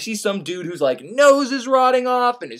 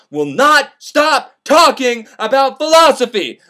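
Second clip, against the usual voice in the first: "not", "stop", "talking" punched loud and separately; slow down and hammer each word of "will not stop talking"; emphatic exasperation; 5 semitones higher, 6 dB louder, slower than usual. Retold or imitated. Narrator fed up with Pangloss, emphatic exasperation.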